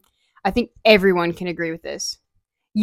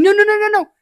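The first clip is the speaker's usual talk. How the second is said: high, loud string of no; panicked denial; 12 semitones higher, 6 dB louder, much faster than usual. Retold or imitated. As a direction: squeal no as the car closes in. Bracing for crash, retold.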